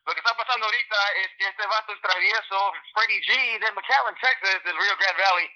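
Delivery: rapid South Texas Spanish, pitched slightly up; quick Spanish shout-out in a South Texas accent. Shown as a demonstration. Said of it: "do I do this in my South Texas voice".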